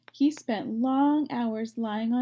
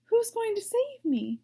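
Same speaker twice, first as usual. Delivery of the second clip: much higher eager plea; excited cry; 12 semitones higher than usual. Acted. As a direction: beg eagerly. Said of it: "cried Wilbur".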